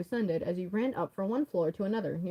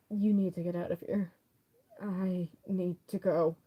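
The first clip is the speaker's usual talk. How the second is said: ordinary talk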